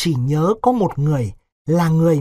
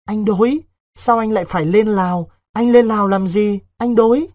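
put-on high, louder anxious young woman; pleading village girl; 6 semitones higher, 4 dB louder than usual. Vịnh asks Đối why he must go to Laos, pleading. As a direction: plead with him in a raised, higher voice.

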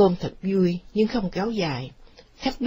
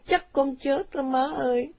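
high plaintive whimper; a touch of tearful weakness; tearful daughter; 6 semitones higher than usual.

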